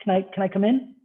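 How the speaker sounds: a lightly voiced shy candidate peeking in; timid asking. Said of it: "do you open a door a little and say, like".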